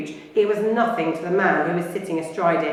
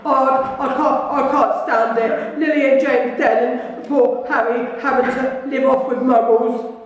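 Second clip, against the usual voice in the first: slow, louder, tearful giant's lament; Hagrid weeping; 5 semitones higher, 5 dB louder than usual. Acted. Hagrid grieving for Harry's parents, loud and tearful. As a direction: break down over the orphan.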